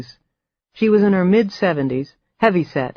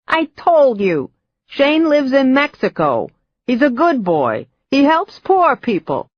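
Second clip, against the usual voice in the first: put-on cackling old mother; 9 semitones higher, 3 dB louder than usual. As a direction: insist like a stubborn old witch. Old mother defending son.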